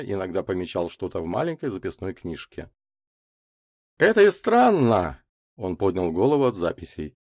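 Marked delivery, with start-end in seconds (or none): 4.0-5.2 high, slow, emphatic remark; puzzled professor. A narrator's reading. Лазарев looks up from his notes, puzzled. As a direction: dwell on the oddity.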